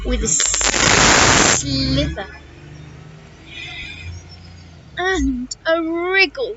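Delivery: drawn-out hissing s and buzzing z; slippery snake. Acted. Snake answering how she'll cross, slow hissing voice. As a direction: stretch the sibilants slowly like a snake.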